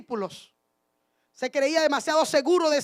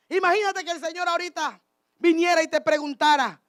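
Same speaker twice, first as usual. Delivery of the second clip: ordinary talk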